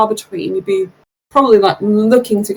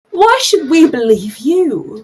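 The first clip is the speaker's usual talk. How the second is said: sceptical woman in crowd; 6 semitones higher, slower than usual. Acted. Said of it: "A woman sort of yells out".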